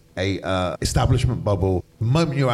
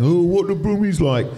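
raised, louder teasing jibe; mocking Londoners; 7 semitones higher, 5 dB louder than usual. London mates mocking Brummies, teasing. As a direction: take the mick, pitched up and loud. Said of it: "take the mick out of the accent".

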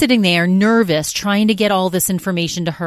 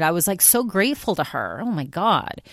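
ordinary delivery

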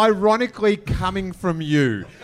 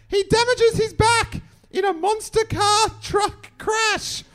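very high, put-on letter-reader voice; comic high-pitched declaiming of a letter; Green Guide letter voice. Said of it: "I'll read this in the Green Guide letter voice".

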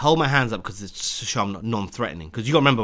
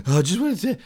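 out of breath; 9 semitones higher, 5 dB louder than usual. Retold or imitated. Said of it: "I sound like an idiot".

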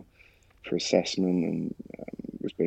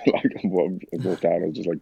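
usual speaking voice

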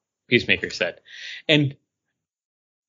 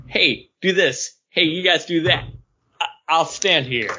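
bossy leader orders; 5 semitones higher than usual. Retold or imitated. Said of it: "other than, like".

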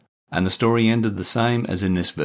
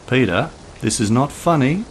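irritated call, raised and higher; 7 semitones higher, 4 dB louder than usual. Acted. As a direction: call out, annoyed now. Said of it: "she called again".

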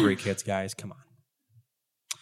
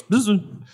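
short high bee buzz; 9 semitones higher than usual. Acted. Game master as bee told to kill, high buzz. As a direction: give a quick questioning buzz.

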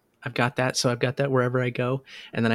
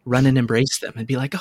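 usual speaking voice

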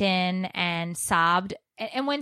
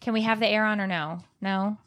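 ordinary talk

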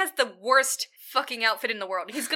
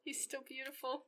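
very soft, reverent repeat; soft awed echo of a friend; hushed echo; 4 semitones higher, 17 dB quieter than usual. Real, not acted. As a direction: murmur it back lovingly.

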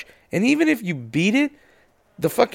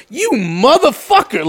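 indignant curse; 7 semitones higher, 4 dB louder, slower than usual. Retold or imitated. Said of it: "And I'm like".

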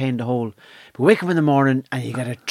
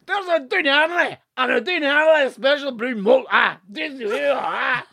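high, hammed-up Scottish caricature; thick Scottish accent; 9 semitones higher than usual. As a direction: reel off the brands in broad, high Scots. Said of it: "man behind the trolley had the same accent".

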